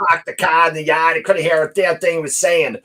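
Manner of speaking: high, loud mocking complaint; mock complaining listener. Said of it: "They'll be like".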